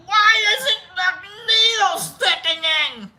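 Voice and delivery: high, drawn-out mock nurse wail; exasperated nurse. Nurse hacking at the vein, high exaggerated wail. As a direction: wail it slowly as a frustrated nurse. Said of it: "She's like".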